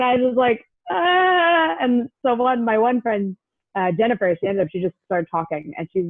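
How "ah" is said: high, drawn-out wordless whine; mock helpless squeal; starstruck whine. Frozen fan answering the drummer, high mock whine.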